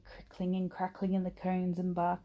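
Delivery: usual speaking voice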